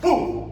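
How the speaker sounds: high, loud "boom"; impact sound. Shown as a demonstration. Teacher drills kids shooting legs back, sharp boom. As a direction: pop out a boom on the move.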